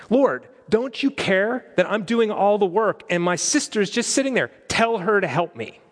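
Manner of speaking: exasperated Martha. Retold or imitated. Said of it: "she goes to her guest and imposes on him".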